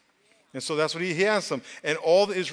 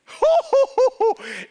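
1 laugh; 5 dB louder than usual. Real, not acted. Laugh after fire call.

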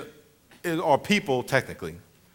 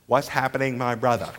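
lower, toned-down Chinese-accented imitation; attempted Chinese accent; 5 semitones lower than usual. Comic imitating his pupils repeating it, light Chinese accent. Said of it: "they had a Chinese accent".

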